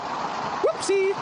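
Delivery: squeaky playful whoopsie; high mock whoopsie. Cohost mocking Salvo's quick exit with a high whoopsie. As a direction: toss off a high little whoopsie. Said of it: "mock exit remark".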